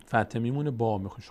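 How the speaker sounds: ordinary talk, nothing marked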